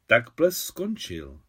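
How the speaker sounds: slow, sighing "Tak ples skončil"; with a deep sigh. Acted. Maria at the harbour, sighing slowly after the ship. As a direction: let the words out on a sigh. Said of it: "s hlubokým vzdechem".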